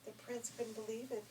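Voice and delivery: ordinary delivery, nothing marked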